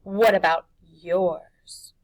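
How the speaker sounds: put-on slow, loud, steady boy; calm challenge. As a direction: turn it back on her. Harvey challenges Mar.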